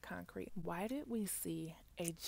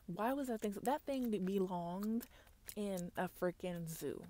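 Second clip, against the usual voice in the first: ordinary delivery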